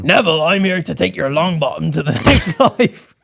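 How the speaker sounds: a hint of a put-on Reaper accent to Neville; grand mock Reaper greeting; lightly voiced Grim Reaper.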